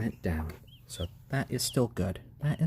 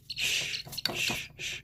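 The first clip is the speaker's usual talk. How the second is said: soft unvoiced shushing to calm a chick; soothing shh for a nervous bird; 7 dB quieter, slower than usual. Real, not acted. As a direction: hush it gently, breath only. Soothing chick during weigh-in.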